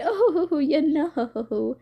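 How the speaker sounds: high, wavering put-on voice; drawn-out wobbly mock reply; sing-song, quavering theatrical tone; Ruin. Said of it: "Ruin's just like".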